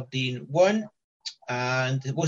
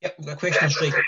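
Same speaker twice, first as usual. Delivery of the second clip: glitchy robotic distortion, fast; faster than usual. Real, not acted. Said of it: "hear that robotic voice".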